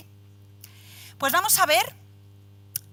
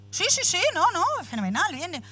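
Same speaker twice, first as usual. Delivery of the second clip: higher and louder, eager certainty that fades at the end; enthusiastic coach; 8 semitones higher, 5 dB louder than usual. Retold or imitated. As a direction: brush off the mother's doubt with a cheerful rush. Coach reassures doubtful mother.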